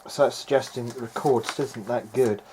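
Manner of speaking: ordinary talk, nothing marked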